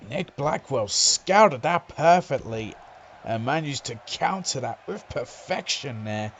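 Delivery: ordinary delivery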